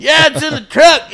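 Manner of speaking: loud, high mock reply; oblivious farm kid. Farm kid mistaking six pack for beer, oblivious. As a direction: answer brightly, missing the point entirely.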